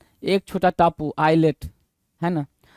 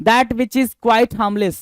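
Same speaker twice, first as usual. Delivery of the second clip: slow dictation; 3 semitones higher, much slower than usual. Shown as a demonstration. Teacher dictating a definition.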